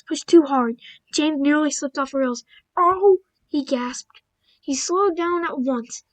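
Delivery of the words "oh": startled gasp. Acted. James gasps near derailing.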